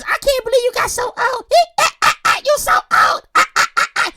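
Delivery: very high-pitched cackling mom imitation; shrieky teasing with staccato fake laughs; mom's mocking laughter. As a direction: squeal it through put-on laughter. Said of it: "She's just laughing".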